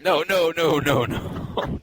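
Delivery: high, insistent string of denials; emphatic protest. Real, not acted. Cohost denying he cracked a racial joke, emphatic protest.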